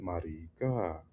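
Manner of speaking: drawn-out Paisa "Marica", slow and soft; Paisa accent. Shown as a demonstration. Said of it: "really Paisa accent".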